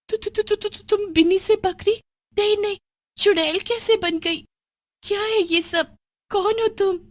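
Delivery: very high, stuttering in fear; panicked at the monster; terrified stammer. Acted. Friend stuttering in terror at the goat witch, very high.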